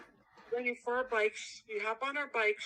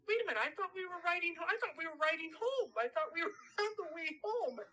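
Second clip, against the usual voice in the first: confused; 8 semitones higher, faster than usual. Retold or imitated. Retelling confusion as a kid.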